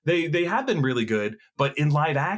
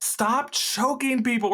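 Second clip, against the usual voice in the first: exasperated plea; 12 semitones higher, slower than usual. Real, not acted. Exasperated at villain's one move.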